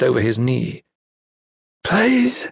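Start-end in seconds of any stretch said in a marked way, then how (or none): 1.8-2.5 pleading, pitched way up; old woman begging, voice high. Acted. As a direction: beg him to spare the bow. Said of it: "she begged".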